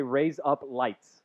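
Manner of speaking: "raise up lights" spoken slowly like Aussie "razor blades"; Australian-sounding wordplay. Shown as a demonstration. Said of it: "it sounds like razor blades in".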